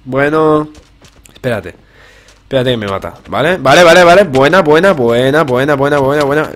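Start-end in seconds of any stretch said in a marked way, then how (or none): 3.6-6.5 loud, high burst of repeats; hyped-up gamer chatter; urgent excitement. Real, not acted. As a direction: cheer each hit as it lands. Landing hits in the fight.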